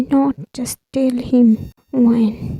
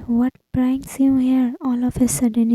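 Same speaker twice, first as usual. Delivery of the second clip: ordinary delivery, nothing marked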